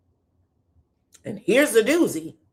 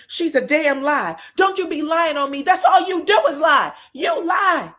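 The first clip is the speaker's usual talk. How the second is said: higher, louder, insistent accusation; heated denial; 7 semitones higher, 6 dB louder than usual. Retold or imitated. Tisha accusing Destiny, getting loud. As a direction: throw it back at her.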